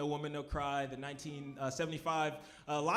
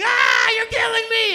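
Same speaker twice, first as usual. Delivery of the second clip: high, very loud shriek of agony; hammed-up death scream; screaming burger; 18 dB louder than usual. Burger on the grill cries to its cook, screaming.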